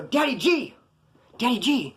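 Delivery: high-pitched call by nickname; retold urgent calling. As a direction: imitate calling someone out of a trance. Retold calling to a man in trance.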